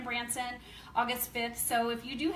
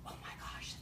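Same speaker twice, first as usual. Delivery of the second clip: hushed excited whisper; 14 dB quieter than usual. Retold or imitated. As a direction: breathe it, barely voiced, amazed. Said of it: "I'm like".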